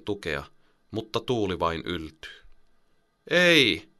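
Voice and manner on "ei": sudden high, loud cry of alarm; shout. Acted. Boy cries out as the net sways in the wind. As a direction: yell out in fright. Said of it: "Rasmus huutaa".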